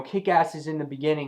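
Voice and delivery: ordinary talk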